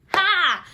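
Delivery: a sudden high, loud burst of "Ha!"; shocked laughing exclamation. Real, not acted. Vlogger stunned the verse matches her dream, loud amazed "Ha!". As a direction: blurt out amazed disbelief.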